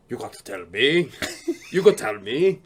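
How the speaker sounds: low mock Austrian accent; Arnold Schwarzenegger. Star prying out a secret, low mock Austrian accent. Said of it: "he'll be like".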